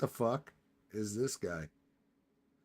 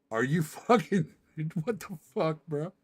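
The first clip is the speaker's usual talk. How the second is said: higher, giggling what the fuck; laughing disbelief; 4 semitones higher than usual. Real, not acted. Streamer laughing at the news clip, higher incredulous. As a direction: laugh through the outrage.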